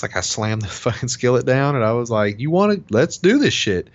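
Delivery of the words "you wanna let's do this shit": retold heated challenge, high and loud; angry challenge. Husband reenacting a kitchen quarrel with his wife, angrily challenging. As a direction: square up for a fight, pitch raised.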